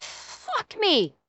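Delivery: ordinary delivery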